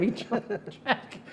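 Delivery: hushed, breathy aside; whispered telling. Retold or imitated. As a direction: drop to a whisper for the punchline. Hushed retelling.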